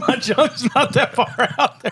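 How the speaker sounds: high, slow laughing voice; cracking up mid-sentence. Real, not acted. Laughing at the junk joke.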